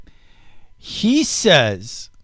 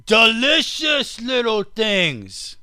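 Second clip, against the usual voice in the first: high, slow, loud hammed-up Aussie voice; mock Australian accent; 8 semitones higher, 5 dB louder, much slower than usual. Host quotes the rancher on chicken brains, in mock Australian accent. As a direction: stretch it out in a silly Australian accent. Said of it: "do I sound like an Australian?".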